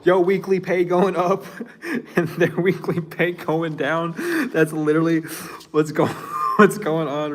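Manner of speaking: words bubbling with real laughter, pitch up; laughing while talking. Real, not acted. Reader gloating to viewers about pay, laughing. Laughing at their money going down.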